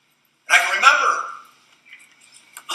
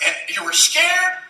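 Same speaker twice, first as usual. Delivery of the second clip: ordinary talk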